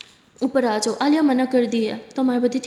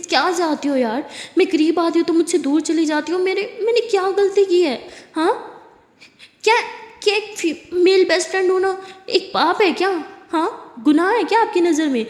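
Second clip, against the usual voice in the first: angry accusation; 3 semitones higher than usual. Acted. Girlfriend confronts Aliya angrily.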